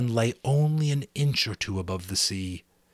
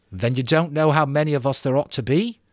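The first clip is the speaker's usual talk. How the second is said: put-on louder, raised boy voice; Ralph voice; 7 semitones higher, 8 dB louder than usual. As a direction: voice Ralph, firm.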